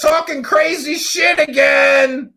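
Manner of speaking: high, loud, drawn-out mocking shout; mock kid taunt. Host voices her kids complaining about Mom online, high mocking shout. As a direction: holler it slowly like an embarrassed kid.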